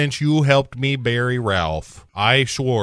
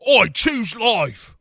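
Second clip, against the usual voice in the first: mock Trainspotting line; 9 semitones higher, slower than usual. Trainspotting quote voiced slowly.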